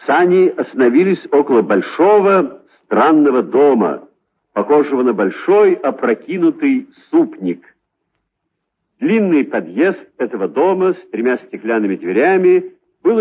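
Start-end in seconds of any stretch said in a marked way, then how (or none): none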